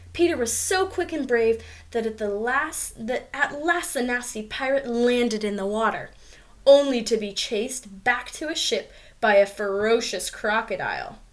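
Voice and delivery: ordinary delivery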